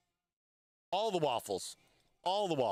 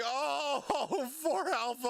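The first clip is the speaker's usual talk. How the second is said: laughing groan; 6 semitones higher, much slower than usual. Real, not acted. Laughing cry at bad luck.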